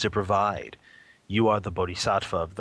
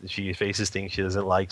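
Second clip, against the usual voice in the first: ordinary talk, nothing marked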